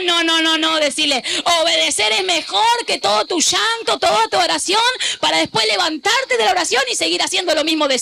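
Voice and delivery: high, insistent preacher's voice; fervent pulpit exhortation; full-force preaching. Acted. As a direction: rouse the congregation to repeat each phrase.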